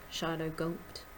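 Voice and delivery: usual speaking voice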